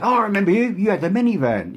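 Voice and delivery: hammed-up Cockney greeting; Cockney accent. Russell recognising him in the van, mock Cockney. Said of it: "he spoke in a Cockney accent".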